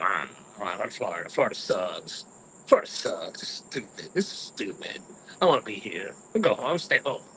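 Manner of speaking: low, sour grumble; salty grumbling. Retold or imitated. On the plane to Florida, a low salty grumble. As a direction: mutter it like a sulking teen. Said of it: "And I'm all salty".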